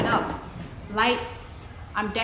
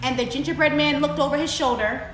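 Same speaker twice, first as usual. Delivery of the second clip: story narration; 4 semitones higher, 6 dB louder than usual. A narrator's reading. Telling children the fox pounces, dramatic narration. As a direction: punch out the sudden grab.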